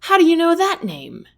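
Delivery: shocked he knows the name; startled. Acted. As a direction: caught off guard, demanding. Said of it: "Tennyson jolts his head back".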